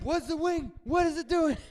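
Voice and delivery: high, loud startled questions; alarmed passengers. Retold or imitated. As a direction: wake up in a panic.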